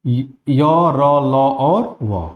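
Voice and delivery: य र ल और व, drawn out very slowly; य र ल व, stretch each sound out; slow semivowel demo. Shown as a demonstration. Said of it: "वह है". (य र ल व धीरे-धीरे खींचकर बोलें)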